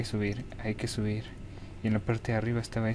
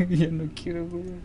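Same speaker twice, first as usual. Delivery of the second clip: high, loud wail of giving up; high-pitched distressed protest; scared whining; 7 semitones higher, 5 dB louder than usual. Real, not acted. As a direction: whine to quit in fright. Scared player wants to quit.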